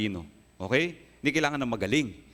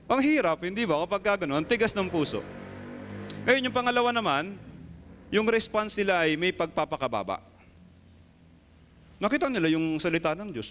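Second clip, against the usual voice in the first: full-force preaching; 6 semitones higher than usual. Acted. Pastor warning the congregation, raised passionate preaching. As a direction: drive the point home to the congregation.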